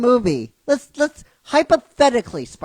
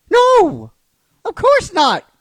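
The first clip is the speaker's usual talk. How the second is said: loud, higher flat denial; emphatic no; 6 semitones higher, 5 dB louder, slower than usual. Real, not acted. Answering chat about box office, loud emphatic no. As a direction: shut the idea down hard.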